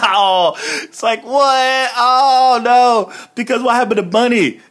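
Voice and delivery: hammed-up high panic about Bunny; mock horror. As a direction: pretend to be shocked at the stew. Voicing horror about Bunny stew.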